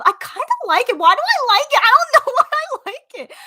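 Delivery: high giggling disbelief at herself; squeaky giggle at her own idea; giggly. Real, not acted. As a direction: laugh through it, delighted. Giddy about her own title idea.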